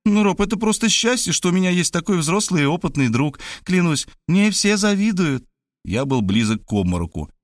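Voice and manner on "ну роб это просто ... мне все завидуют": put-on high girlish voice, earnestly bragging; boastful young woman. A narrator's reading. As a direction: brag and flatter, pitched high. (высокий девичий голос, хвастливо и убеждённо)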